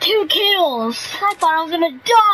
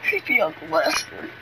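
creepy voice; 10 semitones lower, 6 dB quieter than usual. Pretend. Naming a creepy character.

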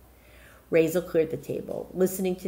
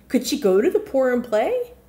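usual speaking voice

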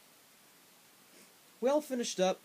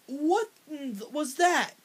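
high, slow, loud disbelief; stunned high slow what was that; shocked outburst; 8 semitones higher, 7 dB louder, much slower than usual. Retold or imitated. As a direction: gasp it out at the explosion. Reacting to exploding bike.

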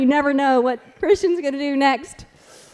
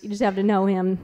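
ordinary talk

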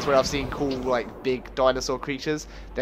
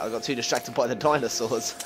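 ordinary delivery

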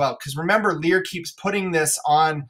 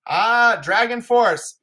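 normal talking voice

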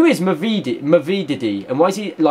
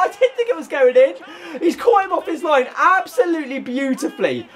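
laughing in disbelief; 9 semitones higher than usual. Real, not acted. Laughing after surprise goal.

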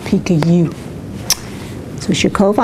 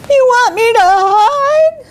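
high bird voice; 13 semitones higher, 3 dB louder than usual. Acted. Hurt bird protests.

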